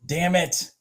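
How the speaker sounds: ordinary delivery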